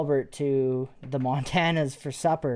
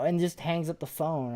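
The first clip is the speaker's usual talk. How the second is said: ordinary talk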